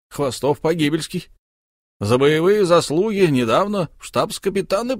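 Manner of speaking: lightly voiced boisterous count; jovial count. A narrator's reading. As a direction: boast about an old comrade. Host introduces his comrade.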